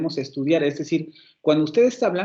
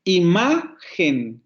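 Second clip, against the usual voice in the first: "Imagen" very slowly, MA stretched out; slow "Imagen" falling away after a long MA; stress on second syllable; 2 semitones higher, 3 dB louder, much slower than usual. Shown as a demonstration. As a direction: hit and hold MA in "Imagen". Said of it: "En la segunda sílaba vuelve a caer el acento".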